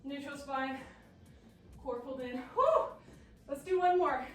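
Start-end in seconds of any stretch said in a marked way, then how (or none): 2.5-2.8 high, loud exhausted whew. Real, not acted. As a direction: blow out a loud breath. Exhale after hard set.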